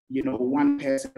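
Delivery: ordinary talk, nothing marked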